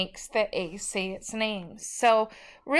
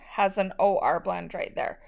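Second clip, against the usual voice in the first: ordinary talk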